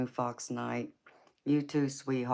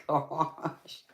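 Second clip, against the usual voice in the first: normal talking voice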